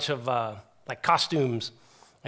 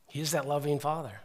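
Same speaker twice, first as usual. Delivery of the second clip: ordinary delivery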